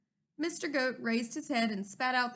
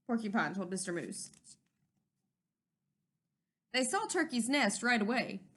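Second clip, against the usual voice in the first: usual speaking voice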